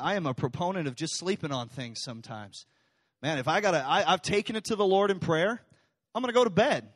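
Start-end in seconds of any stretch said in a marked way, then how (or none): none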